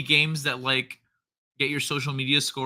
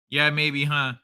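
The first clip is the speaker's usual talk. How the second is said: usual speaking voice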